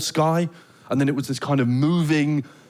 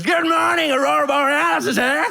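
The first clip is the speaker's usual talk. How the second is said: high-pitched holler, sustained at the top; shouted wake-up call; 13 semitones higher, 3 dB louder than usual. Acted. British man waking the couple for the Northern Lights, high shouted call. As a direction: bellow it like an overeager human alarm clock.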